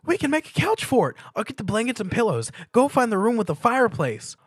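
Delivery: high, loud, rushing with plans; excited pony. A narrator's reading. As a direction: rattle off the plan with glee. Pinkie excitedly plans the fort.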